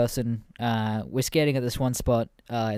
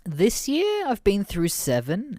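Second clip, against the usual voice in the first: high, loud, slow boast; casual kid brag; 10 semitones higher, 4 dB louder, slower than usual. Retold or imitated. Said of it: "And he's like".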